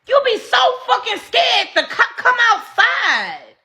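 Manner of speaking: mocking laugh through the shout. Real, not acted. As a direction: crack up while yelling at her. Laughing rant at the abuser.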